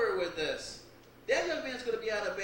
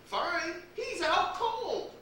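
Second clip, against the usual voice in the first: alarmed; 8 semitones higher, 4 dB louder, much slower than usual. Acted. Alarmed at unconscious guest.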